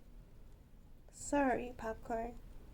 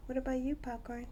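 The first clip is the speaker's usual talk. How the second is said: ordinary talk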